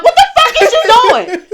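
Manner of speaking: high, loud, laughing disbelief; laughing mock yell. Calling from the pool to a friend, loud laughing yell. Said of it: "I'm in the pool like".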